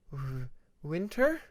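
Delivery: breathless, slow stammered name; shocked low voice. Acted. Moon stunned at meeting her old friend Winter again. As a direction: stammer as if the air left your throat. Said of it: "her voice low, as if the air had been sucked out".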